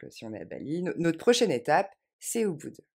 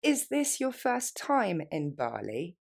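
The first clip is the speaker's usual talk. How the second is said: is this your first time in Bali said slowly and clearly; slow it right down for the learner; slower than usual. Shown as a demonstration. Teacher to students, slow model phrase. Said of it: "Encore une fois plus lentement".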